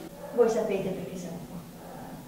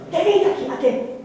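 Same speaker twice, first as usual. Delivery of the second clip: indignant; 5 semitones higher, 5 dB louder than usual. Acted. Defendant objects indignantly.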